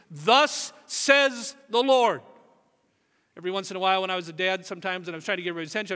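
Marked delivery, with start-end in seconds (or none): 0.1-2.2 slow booming prophet voice; grand biblical pronouncement; solemn proclamation. Retold or imitated. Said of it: "these kinds of phrases".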